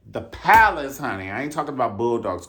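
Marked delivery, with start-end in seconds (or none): 0.1-1.0 loud, high hype call; hyping up the old club again; excited shout-out. Acted. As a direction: call it out louder still. Hyped shout-out.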